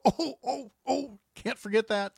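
high, startled oh-oh-oh; suddenly remembering, alarmed; sudden panic. Retold or imitated. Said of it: "listeners who go".

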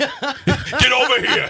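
high, drawn-out mock New York yell; hammed-up hostile New Yorker accent, half laughing; joke New York accent. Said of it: "What is this accent?".

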